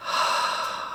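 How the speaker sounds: long audible sigh out; centering out-breath; demonstrated exhale. Shown as a demonstration. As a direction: let the breath go in a soft sigh. Models taking a few breaths.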